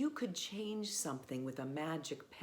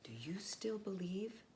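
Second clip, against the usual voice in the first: whisper; 5 semitones higher than usual. Acted. Reader asks children about magic in a hushed whisper. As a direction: lean in and whisper the question.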